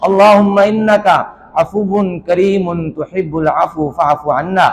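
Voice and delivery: slow, low Arabic recitation; Arabic supplication. Shown as a demonstration. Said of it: "یوں دعا کیجئے". (عربی دعا کی دھیمی، ٹھہری ہوئی تلاوت)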